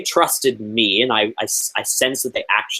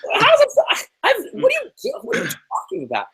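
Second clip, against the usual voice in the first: very high, sputtering disbelief; can't make sense of it; high squeaky what-are-you-talking-about; incredulous; much faster than usual. Real, not acted. Incredulous at Will's claim.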